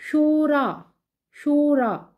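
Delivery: शूरा with a long oo, shoo-raa; teacher showing the wrong शूरा; wrong pronunciation. Shown as a demonstration. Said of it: "शूरा गलत है".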